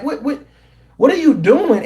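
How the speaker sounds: asks in pure disbelief what she is doing; incredulous. Real, not acted. A host baffled by the runner asks what she's doing, incredulous.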